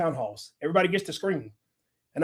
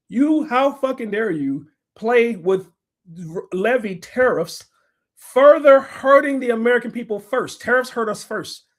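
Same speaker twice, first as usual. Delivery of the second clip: slow, loud, high tirade; furious slow tirade; 4 semitones higher, 4 dB louder, slower than usual. Real, not acted. Vlogger lecturing Trump on tariffs, slow furious rant. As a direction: spit the words out slowly in anger.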